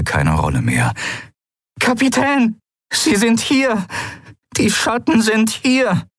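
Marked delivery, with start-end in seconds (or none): 1.8-6.1 high-pitched, panicked warning; alarmed cry. A narrator's reading. Crew member warning the captain of intruders, panicked cry. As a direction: cry it out, slowing on the last phrase.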